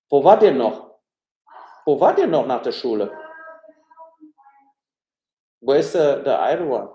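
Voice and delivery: calling across the house. Real, not acted. Father questioning his son about school, loud calling. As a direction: shout questions to someone far off.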